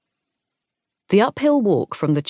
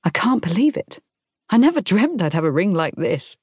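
higher-pitched, amazed delight; thrilled; 5 semitones higher than usual. A narrator's reading. Fiancée admires her new diamond ring, thrilled. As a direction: marvel at it, voice lifted.

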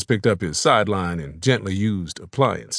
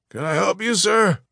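polite shopkeeper; 10 semitones higher than usual. A narrator's reading. Proprietor's polite greeting voiced.

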